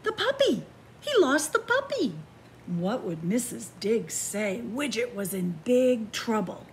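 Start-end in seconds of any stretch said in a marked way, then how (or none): 0.0-2.1 high panicked cry, much higher; mock panic. Reading to children, puppy lost, high mock panic. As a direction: sound the alarm like a storybook panic.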